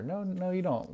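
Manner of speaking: high playful no, no, teasing; mock scolding. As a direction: cut off your own excuse, mock-stern. Scolding own imagined excuse.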